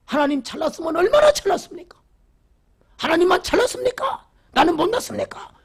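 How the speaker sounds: high-pitched indignant protest, mocked; complaining to God. Elder at dawn prayer quarrelling with God, high mocking protest. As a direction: imitate a man arguing bitterly with God. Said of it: "원망하고 따지는 거예요".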